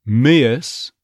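"Miss" stretched to "MI-uhs"; loud, stressed "Miss" sliding into a schwa; stressed off-glide. Shown as a demonstration. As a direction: lean on "Miss" with a glide after the vowel. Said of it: "there's that off glide if you stress it".

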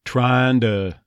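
"Trying to" run together as trahn-duh; joined "Trying to". Shown as a demonstration. Coach shows the linking, "Trying to" as trahnda. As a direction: turn "to" into duh after trahn. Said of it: "to tends to become a D".

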